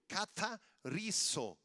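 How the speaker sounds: "catarizo" slowly, broken as cata-rizo; word demonstration. Shown as a demonstration. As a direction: stretch "catarizo" out, stressing the I. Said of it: "con acento en la I".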